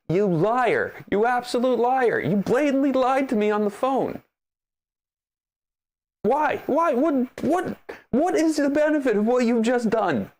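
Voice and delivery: high, rising outrage at being lied to; exasperated, high-pitched telling-off; indignant accusation. Acted. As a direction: confront the liar, voice climbing. Acted angry confrontation of phone agent.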